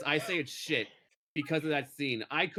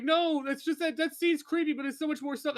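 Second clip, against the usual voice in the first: high pleading friends voice; 10 semitones higher than usual. Retold or imitated. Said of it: "all of his friends were like".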